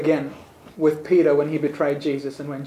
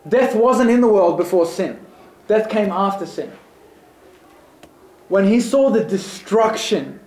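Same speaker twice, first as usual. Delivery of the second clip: higher, louder preacher building to awe; rising preaching; 4 semitones higher, 3 dB louder than usual. Acted. Preacher urging the congregation to imagine God weeping, rising fervor. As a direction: swell with wonder at God weeping.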